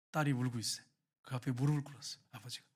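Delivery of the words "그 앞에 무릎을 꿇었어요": usual speaking voice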